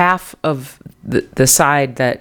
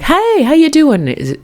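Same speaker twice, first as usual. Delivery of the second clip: friendly greeting; 14 semitones higher, slower than usual. Retold or imitated. Greeting the man in the garage.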